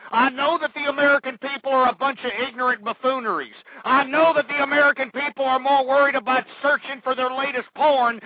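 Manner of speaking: angry rant. Real, not acted. Host berates the American public, angry rant. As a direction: hammer out each accusation.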